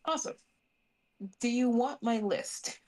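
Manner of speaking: usual speaking voice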